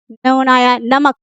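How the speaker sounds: normal talking voice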